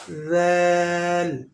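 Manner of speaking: slow soft "ज़ैल", drawn out gently; teacher models a soft "ज़ैल" slowly; soft zal sound. Shown as a demonstration. Teacher models the Arabic letter to students, slow and soft. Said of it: "ज़ाल नरम sound वाला हर्फ है".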